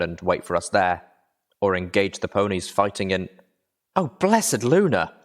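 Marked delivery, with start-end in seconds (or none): 4.0-5.1 high, slow, relieved cry; relieved exclamation. Acted.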